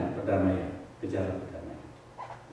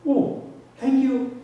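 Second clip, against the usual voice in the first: high, slow, drawn-out surprised thanks; a stretched-out, lilting thank you; imitated grateful reply; 13 semitones higher, much slower than usual. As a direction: mimic the fisherman's startled gratitude.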